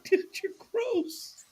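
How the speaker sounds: high, soft, slow mock voice; mock disgusted friend. Host imitating a friend reacting to the stunt, high and soft. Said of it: "and he goes".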